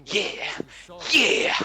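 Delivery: building excited yeah; rising cheer. Retold or imitated. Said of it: "I'm like".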